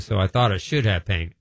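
normal talking voice

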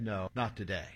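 low, soft, understated no; quiet refusal. Retold or imitated. Grandfather putting off his grandson's plea, quiet and low. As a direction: turn it down quietly, voice dropped low.

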